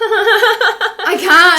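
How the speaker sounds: high, loud laugh through the words. Real, not acted. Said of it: "not talk for a minute".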